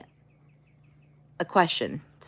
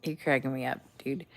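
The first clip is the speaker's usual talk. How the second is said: ordinary delivery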